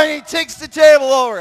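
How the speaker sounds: very loud, high storytelling shout; full-force shouting. Acted. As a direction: shout the rampage loud enough to wake them. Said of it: "I woke some of you up".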